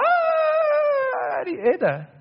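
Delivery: sudden high mock yell; imitated yell. Preacher acting out a quarrel for the congregation, sudden mock yell. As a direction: burst out like someone yelling back. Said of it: "We just yell".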